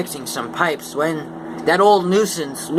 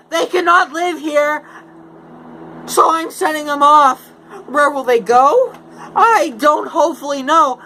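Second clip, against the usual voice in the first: high, loud little-creature voice; Lorax voice; 11 semitones higher, 4 dB louder than usual. Acted. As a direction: squeak it out like the fussy Lorax. Lorax complains, coughing.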